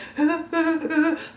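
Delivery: high, heaving mock sobs; imitated sobs. Vlogger showing her movie-theatre crying, imitated sobs. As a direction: pretend to sob in bursts of breath. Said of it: "I was actually having sobs".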